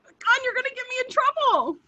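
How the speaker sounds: high, laughing mock complaint; laughing protest. Whispering to Mark at the event, high and laughing. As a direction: giggle out the protest to a troublemaker. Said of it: "and I went".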